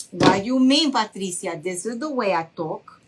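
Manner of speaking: put-on indignant mom with a Honduran accent; mother's Honduran accent. Mom answers her daughter's teasing, in a Honduran accent. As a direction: imitate a Honduran mother defending her talk. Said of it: "she just came from Honduras".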